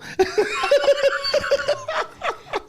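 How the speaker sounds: put-on high, breathy old man laugh; old man cackle. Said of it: "I do an old man voice".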